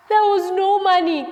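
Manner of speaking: high, loud cry of dismay; alarmed storyteller at full voice. A narrator's reading. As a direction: cry out the bad news. Third empty place, alarm peaks.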